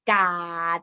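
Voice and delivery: "กาด" with a long vowel and stopped ending; teacher's model of "กาด"; word pronunciation. Shown as a demonstration. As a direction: draw out the vowel in "กาด", then stop. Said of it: "So this word is pronounced".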